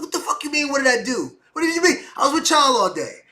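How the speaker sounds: loud high outraged yelling; angry protest. Retold or imitated. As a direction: yell your innocence at the cops.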